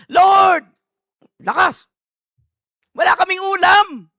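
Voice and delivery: loud, high mock shout; shouting to the Lord. Church member yelling to the Lord for food, comic shout. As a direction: wail it to heaven, hammed up. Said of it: "umiyak siya".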